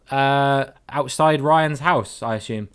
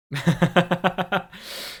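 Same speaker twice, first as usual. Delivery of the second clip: higher, staccato chuckle; staccato laugh; 4 semitones higher than usual. Real, not acted. Streamer amused by English-looking Swindon, staccato laugh. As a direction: burst into a run of short, quick laughs.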